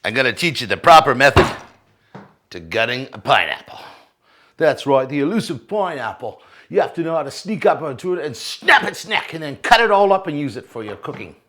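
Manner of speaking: put-on playful Australian wildlife-host accent. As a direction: play the crazy croc hunter, Australian style. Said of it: "I get an Australian accent when I talk about the pineapple".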